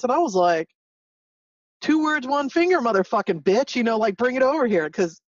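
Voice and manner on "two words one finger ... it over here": high-pitched, cocky retort; mock-tough challenge, higher voice; retold combative comeback. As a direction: pick a fight, pitched way up. Retells own comeback in a quarrel.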